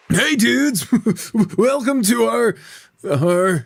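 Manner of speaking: high, upbeat on-air greeting; peppy dude presenter. Acted. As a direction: open the show in a high, hyped voice. Starts news segment in character.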